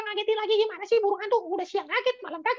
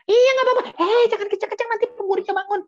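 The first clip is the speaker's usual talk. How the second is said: louder, carelessly loud warning; 6 dB louder than usual. Acted. Mouse repeats the warning without lowering its voice, loud. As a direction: warn about noise while being loud. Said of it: "kebiasaan kalau ngomong kenceng-kenceng".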